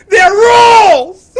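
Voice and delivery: laughing shout. Real, not acted. Laughing at her own mob bit.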